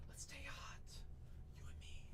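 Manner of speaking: breathy whispered murmur to a buyer. Real, not acted. Breaker whispering to a chat buyer, secretive whisper.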